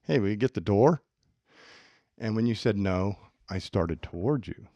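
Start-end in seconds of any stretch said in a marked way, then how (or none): 0.0-1.0 falling, firm order with a question's words; demand not question. Shown as a demonstration. Demanding Mike open the door, firm. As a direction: make the question sound like an order. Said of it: "it really doesn't sound like a question".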